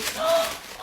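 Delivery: high sharp delighted gasp; a real gasp of delight. Real, not acted. Opening the pink pair, a gasp.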